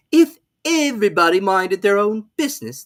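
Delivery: loud growl, high, mock hoarse; Duchess's hoarse growl. A narrator's reading. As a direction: snarl it grandly. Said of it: "in a hoarse growl".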